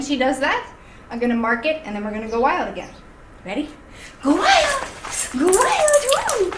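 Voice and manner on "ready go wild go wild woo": very high, loud whooping cue; squealing, loud playful hype; excited play call. Shown as a demonstration. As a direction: rev the dog up to go crazy. Demonstrates revving the dog up.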